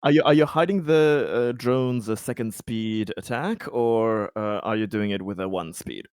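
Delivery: ordinary delivery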